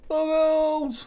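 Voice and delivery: high, loud drawn-out holler; big high whoop of a greeting; yelled catchphrase. Pretend. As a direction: call it out like a rallying cry.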